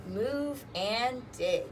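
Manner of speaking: ordinary talk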